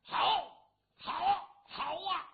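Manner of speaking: high, loud cheers of bravo; rowdy spectators yelling bravo; crowd cheering. Acted. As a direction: bellow it like excited onlookers. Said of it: "旁边就跟着吼".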